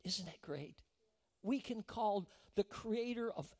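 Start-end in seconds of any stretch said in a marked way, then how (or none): 0.1-0.8 breathy whispered aside. Real, not acted. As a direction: drop to a hushed whisper to the congregation. Preacher's aside to congregation.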